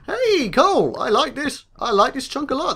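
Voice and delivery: high, bouncy enthusiasm; excited delight. Real, not acted. Player thrilled with a new chunk, excited delight.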